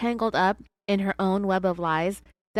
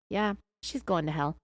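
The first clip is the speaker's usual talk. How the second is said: ordinary delivery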